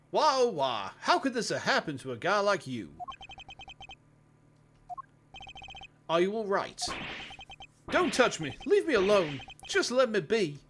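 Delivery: lightly voiced, melodramatic jock; a hint of a distraught, over-the-top jock. Jock grieving over his dead partner, lightly overacted. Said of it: "Over the top jock dickhead".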